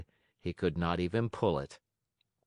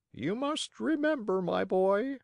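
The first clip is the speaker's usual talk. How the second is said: high, lecturing bird voice; high-pitched wise pigeon; advising pigeon; 12 semitones higher than usual. Acted. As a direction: counsel a boy in a high voice. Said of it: "answered the pigeon".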